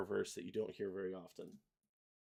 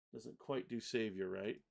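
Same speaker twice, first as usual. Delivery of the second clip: ordinary talk, nothing marked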